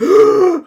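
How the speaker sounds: loud, high mock gasp; audible gasp. Game master imitating someone outside hearing deaths, loud gasp. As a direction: suck in a big shocked breath. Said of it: "this, this audible gasp outside".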